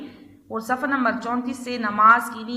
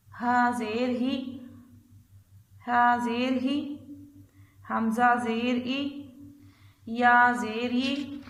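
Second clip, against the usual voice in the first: put-on slow, measured letter-by-letter chant for children; drawn-out classroom recitation of Arabic letters; slow qaida recitation; slower than usual. Shown as a demonstration. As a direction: spell each letter out slowly and clearly. Teacher chants qaida letters slowly.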